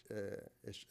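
usual speaking voice

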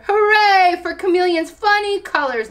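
high-pitched hooray; cried cheer. A narrator's reading. Meerkat celebrating chameleon's funny colors, high cheer. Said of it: "Meerkat cried".

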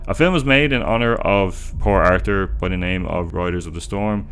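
plain reading voice

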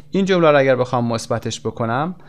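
ordinary delivery

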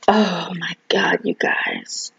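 touched, breathless whisper; awed whisper. Real, not acted. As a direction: hush it in awe. Awed by handwritten note.

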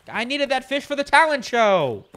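high-pitched complaint; dismayed lament. Real, not acted. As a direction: wail it in mock despair. Laments losing the fish.

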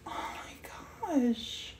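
slow, whispered amazement; awed whisper. Real, not acted. Overwhelmed by the painting Martin did, awed whisper. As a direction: whisper it, drawn out in real awe.

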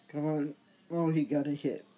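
normal talking voice

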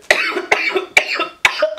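harsh hacking cough; smoker's cough. Real, not acted. Host hacking after a hit of the blunt, harsh cough.

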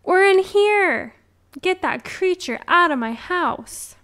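high, loud grandmother's call. A narrator's reading. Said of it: "the grandmother called".

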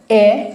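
এ said alone after a short pause, as "ay"; isolated vowel sound. Shown as a demonstration. Teacher showing learners the sound of letter E, এ pronounced by itself. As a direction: set এ apart as a clean vowel. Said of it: "যেটাকে আমি ই বলি".

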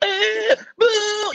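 high, loud imitated babble; two wild high-pitched yelps, hammed up; manic noises. Excitable guest mimicking himself on sugar, manic loud noises. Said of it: "even worse than I am now".

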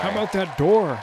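high, put-on doofy delivery; high mock comedian voice. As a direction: deliver it like a smug comic. Imitating comedian's joke.

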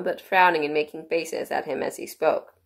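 ordinary delivery

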